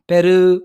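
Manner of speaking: Peru with the final u drawn out; long final vowel. Shown as a demonstration. Teacher repeating the long vowel for the class. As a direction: draw out the u of Peru. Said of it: "prolong yung sound niya".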